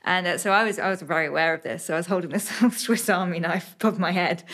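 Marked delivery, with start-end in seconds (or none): none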